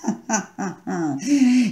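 high, drawn-out evil laugh; menacing villain laugh; sinister laugh. A narrator's reading. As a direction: laugh like a traitor who has won. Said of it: "rise sinistramente".

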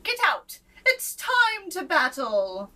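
high, loud commanding knight; bold knight. A narrator's reading. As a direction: order him out like a brave knight. Knight orders king out.

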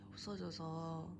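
ordinary talk, nothing marked

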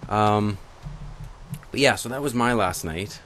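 ordinary talk, nothing marked

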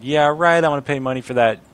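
mock the idea of paying; sneering NPC brushing off payment; sarcastic scoff. Mocking NPC refusal.

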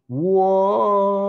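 high, loud cry of alarm; shouted whoa. Acted. Girls on a cardboard sled down the hill, high loud cry. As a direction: yell as the sled takes off. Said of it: "the girl shouted".

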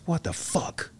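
high, softened cry of disbelief; mock freaked-out. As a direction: imitate someone stunned and freaking out. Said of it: "He freaked out".

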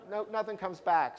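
normal talking voice

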